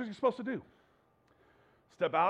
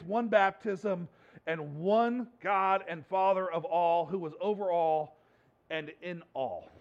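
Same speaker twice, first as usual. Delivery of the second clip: loud, slow, weighty reading; slow scripture reading; 6 dB louder, slower than usual. Acted. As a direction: lay out every word of the verse. Reads Corinthians slowly, loudly.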